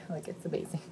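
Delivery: soft awed whisper. Real, not acted. Describing the trips, whispered. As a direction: hush it in awe.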